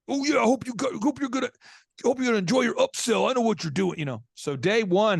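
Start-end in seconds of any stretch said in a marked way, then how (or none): 0.1-4.0 higher mock taunt from an angry biker; sneering biker. Host imitating the angry biker in line. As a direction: needle him like a suspicious tough guy. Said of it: "he was pissed".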